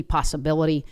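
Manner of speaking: usual speaking voice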